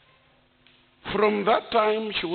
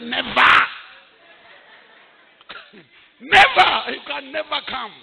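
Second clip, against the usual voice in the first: firm refusal; 5 semitones higher, 3 dB louder than usual. Retold or imitated. Refusing niece's return, laughing.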